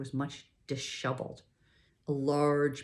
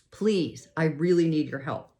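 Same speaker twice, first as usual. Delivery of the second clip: pleading; 2 semitones higher, 7 dB louder than usual. A narrator's reading. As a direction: beg him for help. Girl pleading for help.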